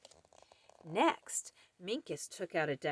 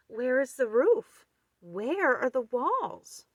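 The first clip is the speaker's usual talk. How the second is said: puzzled; 7 semitones higher, 5 dB louder than usual. Acted. Puzzled mouse questions.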